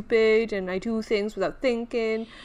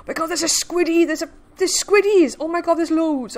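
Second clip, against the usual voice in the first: alarmed; 5 semitones higher, 5 dB louder than usual. Real, not acted. Spots more frightening creatures.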